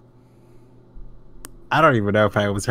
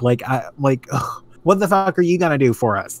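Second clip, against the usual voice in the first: ordinary talk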